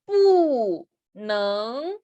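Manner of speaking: Bu falling sharply, neng rising; fourth tone kept. Shown as a demonstration. Teacher showing Mandarin tones, Bu falls and neng rises. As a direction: drop hard on "Bu", then lift "neng". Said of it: "mantenemos el cuarto tono".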